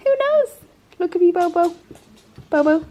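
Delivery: high, squeaky cooing pet voice. Real, not acted. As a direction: coo to the dogs like babies. Cooing at the dogs.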